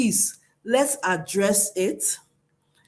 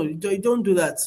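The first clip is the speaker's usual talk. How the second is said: ordinary talk, nothing marked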